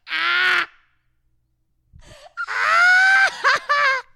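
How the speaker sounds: very high squealing laugh-cry; helpless, loud wail of laughter. Real, not acted. Overcome by her own first-kiss story, laughing till crying. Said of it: "oh my God, I'm crying".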